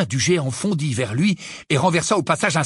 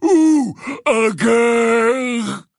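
loud, high, long-held giant bellow; Grope roaring; 7 semitones higher, 5 dB louder, much slower than usual. Acted. Giant demanding an answer from Hermione, roaring. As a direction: roar the question in simple broken words. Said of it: "rugit Grope".

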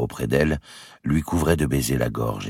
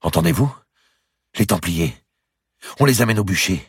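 loud, higher excited cry; 7 semitones higher, 4 dB louder than usual. Acted. Queen hearing the crowd outside, an excited loud cry. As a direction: cry it out, thrilled by the noise. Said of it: "elle s'écria".

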